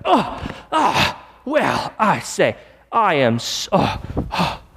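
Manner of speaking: hammed-up indignant gasps starting very high; mock outrage. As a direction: splutter in pretend offence, dragging out the words. Mock outrage at sight of ants.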